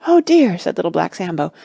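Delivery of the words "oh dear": high, loud storybook alarm; a small boy's worried outcry, pitched up; dismayed boy. A narrator's reading. Boy hears the tigers coming back, dismayed.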